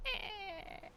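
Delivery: high little kitten bleat; imitating a kitten. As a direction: squeak it out like a tiny unhappy kitten. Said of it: "Sometimes he goes".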